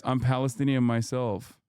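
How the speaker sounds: normal talking voice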